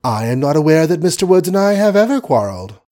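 lofty and dismissive; haughty. Acted. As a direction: say it with cool hauteur. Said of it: "with hauteur".

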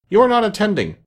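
high, sharp reprimand; severe. A narrator's reading. Mouse catches Alice not listening, severe. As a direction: scold severely, pitched well up. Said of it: "said the mouse to Alice severely".

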